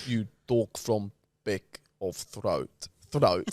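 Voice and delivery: low, slow, South African-sounding Russian accent; Russian accent attempt. Shown as a demonstration. Host coaching co-host, low botched Russian accent. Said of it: "that was South African".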